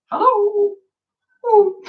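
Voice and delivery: squeaky high-pitched greeting; high squeaky hello. Real, not acted. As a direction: chirp it up high. Waving hello to viewers online.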